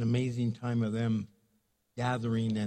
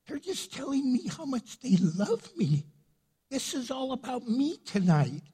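higher, delighted voice for God; God voiced playfully; 10 semitones higher than usual. God pointing angels to the praising women, high delighted voice. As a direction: boast happily like a proud father. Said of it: "He goes".

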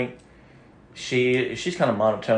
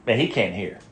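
usual speaking voice

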